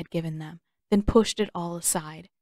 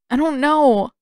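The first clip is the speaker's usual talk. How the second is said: wavering voice; 7 semitones higher, 7 dB louder than usual. Acted. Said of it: "his voice wavered".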